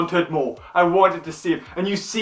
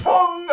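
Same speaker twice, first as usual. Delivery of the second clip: mock bong; 11 semitones higher, 4 dB louder than usual. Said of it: "I just went".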